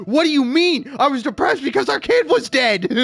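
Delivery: loud, high-pitched indignant shouting; pissed-off outburst. Acted. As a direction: throw the excuse back in outrage. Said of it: "I'd be so pissed".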